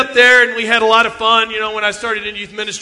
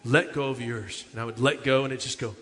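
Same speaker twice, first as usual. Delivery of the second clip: much softer, lower solemn youth minister; hushed solemn minister; 8 semitones lower, 9 dB quieter than usual. Retold or imitated. Youth minister teaching the kids a lesson, hushed. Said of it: "the youth minister would say".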